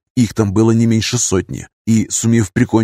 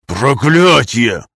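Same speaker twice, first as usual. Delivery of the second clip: slow groaned curse; much slower than usual. Acted. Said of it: "только и смог сказать он".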